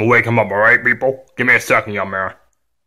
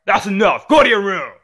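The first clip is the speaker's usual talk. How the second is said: ordinary talk, nothing marked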